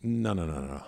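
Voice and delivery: subtle polite no. Retold or imitated. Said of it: "just turns around, kinda way sound like".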